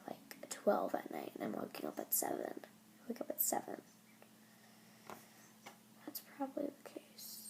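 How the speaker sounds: whispering the late bedtime like a secret. Real, not acted. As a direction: drop to a breathy morning whisper. Sleepy early morning confession aside.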